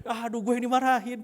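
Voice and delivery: high, loud comic moan; mock lament.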